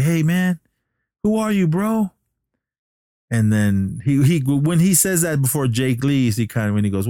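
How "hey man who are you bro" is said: high-pitched questioning call; retold puzzled call. Billy to departing Jake, imitated puzzled call. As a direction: imitate bewildered calling after a friend.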